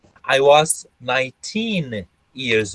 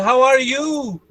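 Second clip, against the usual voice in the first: un-British intonation; 9 semitones higher than usual. Shown as a demonstration. Teacher showing what the British don't say, exaggerated Brazilian intonation. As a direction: stretch "you" high in a Brazilian lilt. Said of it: "And they don't say".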